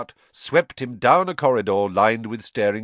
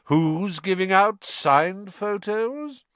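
high, slow, showy question; jovial grand teacher; 11 semitones higher, 4 dB louder, slower than usual. A narrator's reading. Vain teacher hearing of autographs, grandly jovial. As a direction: sing it out grandly. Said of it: "thundered jovially".

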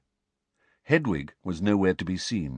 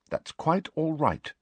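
with dignity; 9 semitones higher than usual. A narrator's reading. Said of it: "said Hermione with dignity".

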